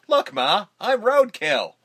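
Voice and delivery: high, loud cartoon quip; goofy cartoon voice. Flattened hero quips to the camera, high cartoon voice.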